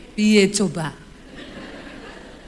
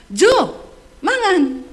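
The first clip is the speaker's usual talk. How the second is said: loud, higher call across the house; 6 semitones higher, 4 dB louder, much slower than usual. Retold or imitated. Wife calling her husband to eat, loud shout. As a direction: holler the name, then the order. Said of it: "pertama kali saya teriak sama suami saya".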